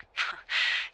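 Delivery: brief breathy chuckle; quick voiceless chuckle; short laugh. Acted. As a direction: let out a little amused puff of laughter. Teasing good afternoon after long sleep.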